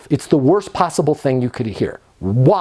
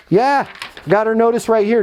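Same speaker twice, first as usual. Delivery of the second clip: cheery confirmation; 7 semitones higher than usual. Acted. Agent checks notice, acted call.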